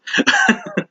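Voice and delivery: short high laugh; excited giggle. Real, not acted. Fight reviewer excited about the card, short high laugh. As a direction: let out a quick giggle.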